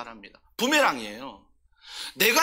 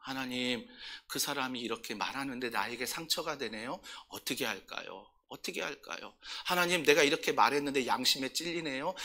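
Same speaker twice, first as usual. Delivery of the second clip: much softer, pleading prayer voice; 8 semitones lower, 10 dB quieter than usual. Shown as a demonstration. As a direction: pray quietly, handing everyone over to God. Demonstrates confiding prayer to God.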